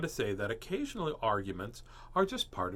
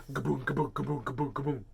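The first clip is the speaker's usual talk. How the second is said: door banging noise. A narrator's reading. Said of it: "banging on the door".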